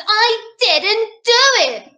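put-on slow, high child's protest; storybook girl denying it; little princess. A narrator's reading. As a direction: insist slowly, pitched right up. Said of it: "said the little princess".

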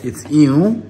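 playful insistence. Real, not acted. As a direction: insist it back, teasing. Arguing with child over ew.

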